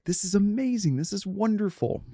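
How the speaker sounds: high, lightly hammed-up self-satisfied gushing; mock gushing excitement. Recalling the couple's private delight, a mock gush. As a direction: play it as smug delight at your own generosity. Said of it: "in our heads, we were like".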